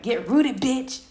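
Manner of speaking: high and loud, bossing herself; tough-love shout to herself; fierce self pep talk. Retold or imitated. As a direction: hype yourself up hard. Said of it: "And I was like".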